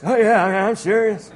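a hint of an old man, half laughing; lightly voiced old Abram, eager; ninety-nine-year-old Abram. Preacher voicing old Abram answering God, lightly aged voice. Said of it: "Let me get the voice".

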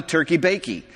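"bakey" for bacon, ending in -ee; muddled words. Retold or imitated. Customer ordering at the drive-thru, muddling bacon into "bakey". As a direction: slip up and say "turkey bakey". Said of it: "I just muddled the words".

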